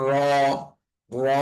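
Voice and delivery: র said thick and rounded, "raw"; slightly heavy, held র; heavy, full sound. Shown as a demonstration. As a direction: fill the mouth on র. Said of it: "রটা একটু মোটা হবে".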